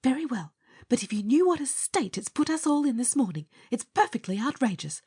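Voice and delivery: high, rapid scolding; outraged nurse. A narrator's reading. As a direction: scold him indignantly in a rush.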